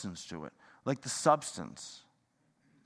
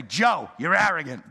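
mock accusation; 2 semitones higher, 8 dB louder than usual. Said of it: "Well, okay".

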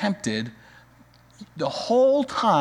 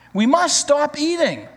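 high, louder protest; crying out; 10 semitones higher, 4 dB louder than usual. Acted. Toad crying out to Frog while eating cookies, high and louder. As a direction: cry out while still eating. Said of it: "cried Toad as he ate another".

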